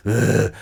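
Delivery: rough throaty "ugh"; demented growl. Acted. Acting out the feeling of smoking cigarettes, demented growl. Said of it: "this kind of demented feeling I had".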